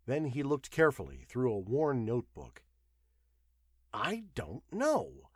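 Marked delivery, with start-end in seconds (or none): 3.9-5.3 slow, higher-pitched admission; puzzled confession, words drawn out; confessing. A narrator's reading. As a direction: admit it slowly, at a loss. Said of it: "he confessed".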